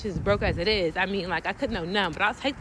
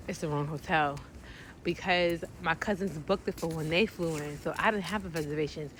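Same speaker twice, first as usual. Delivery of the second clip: lower, softer letdown; telling viewers the hotel was wrong, softer and lower; deflated; 4 semitones lower, 6 dB quieter than usual. Real, not acted. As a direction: let it sink in quietly. Deflated realisation.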